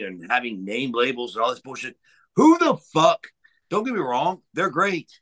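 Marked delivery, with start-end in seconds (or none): 2.4-3.3 sudden loud, high exclamation; incredulous yell at the bookers; outraged outburst. Real, not acted. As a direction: blurt it out in disbelief.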